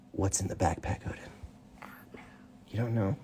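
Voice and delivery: ordinary delivery, nothing marked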